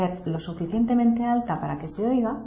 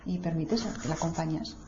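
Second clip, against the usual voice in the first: usual speaking voice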